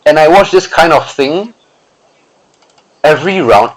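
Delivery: "watch" 강조, "thing"을 넣어 다시 읽기; corrected reading. Shown as a demonstration. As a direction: stress on "watch", "thing" added, read rising then falling.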